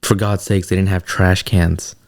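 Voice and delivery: usual speaking voice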